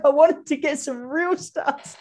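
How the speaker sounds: put-on high, slow, drawn-out child's whine; little boy's slow, teary whine; whining little kid. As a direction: stretch it out like a sulking boy. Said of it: "he's like".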